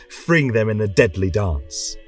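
plain reading voice

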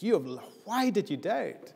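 why did you doubt rising high and slowed, sharp rebuke; angry rebuke. Shown as a demonstration. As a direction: bite out the question as a rebuke. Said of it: "angrily as a rebuke?".

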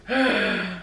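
long uneasy sigh; nervous sigh. Real, not acted. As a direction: brace yourself with a sigh. Before drinking medicine.

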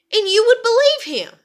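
high, loud retold challenge; emphatic quoted accusation. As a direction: throw it back at grandmother, loud and high. Quoting own challenge to grandmother.

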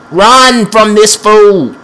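high, loud, drawn-out yell; alarmed warning. Real, not acted. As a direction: stretch out a loud cry to flee. Fleeing opponent in game.

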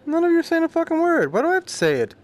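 high-pitched exasperated complaint. Real, not acted. Streamer grumbles at silent game characters, exasperated and high. Complains about having to say the word.

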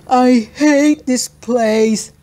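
put-on slow, loud complaining kid voice; whiny. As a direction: drag out the complaint. Freddy complains.

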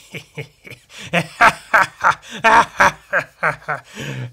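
loud mocking villain laugh; hammed-up booming villain laugh; evil laugh. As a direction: cackle like the big guy.

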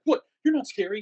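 high, loud scoffing taunt; cocky guest taunting the scare actor. Retold or imitated. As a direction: sneer it like a smug teen. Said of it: "being cocky and be like".

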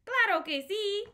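high, loud bright exclamation; cheerful yes. Acted. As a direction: answer the kids with big enthusiasm. Cheerful answer to refrain.

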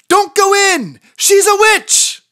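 high, loud interrupting shout; urgent warning. Acted. Chicken jumps into the fairy tale, shouting a high warning. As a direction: burst in to stop them.

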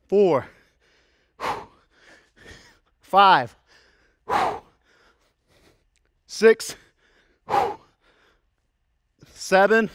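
calling out reps. Real, not acted. Trainer counting the last lunges, calling out loudly. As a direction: belt out each number through the effort.

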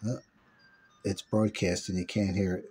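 usual speaking voice

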